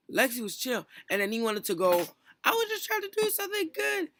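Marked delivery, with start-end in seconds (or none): 2.4-4.1 very high put-on mimicking voice; mock his excuse in a squeaky high pitch; exaggerated high-pitched imitation; mocking whine.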